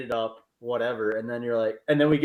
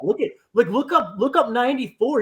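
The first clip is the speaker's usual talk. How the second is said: higher, eager look at this; excited pointing out; 10 semitones higher, 5 dB louder than usual. Retold or imitated. Seth distracting scared friend with the view, excited and higher. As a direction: sell the view to distract him.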